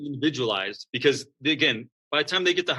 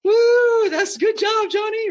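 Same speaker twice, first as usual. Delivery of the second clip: put-on high, loud cheer for a kid's kick; 6 dB louder, slower than usual. Parent at kids' soccer cheering a lucky kick, high loud whoop. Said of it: "everybody cheers".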